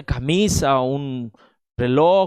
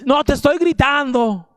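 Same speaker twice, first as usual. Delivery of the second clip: retold husband shouting high and loud; hammed-up yelling denial, much higher; mock angry shout; 9 semitones higher, 3 dB louder than usual. Husband shouts while denying shouting. (el esposo grita a su esposa negando que grita: grito fingido, agudo y fuerte)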